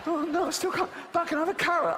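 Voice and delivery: high, whiny voice that can't fit through; panicked fat shopper. Retold or imitated. Comic mocking shoppers stuck at cake door, high whiny panic. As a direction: squeal like you're stuck in the door. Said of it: "and they go back".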